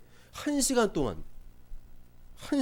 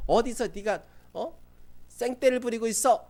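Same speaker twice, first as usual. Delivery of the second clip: put-on higher, louder jeering at a spoiled kid; a peer telling off a whiny kid, higher and louder; mock kid taunting; 7 semitones higher, 4 dB louder than usual. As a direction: scold him like a tough classmate. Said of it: "막 이러면서".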